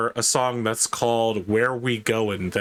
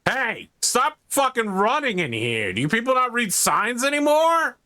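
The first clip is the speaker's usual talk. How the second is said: angry shout; 12 semitones higher than usual. Acted. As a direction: yell at the runner to stop. GM voices angry mechanic.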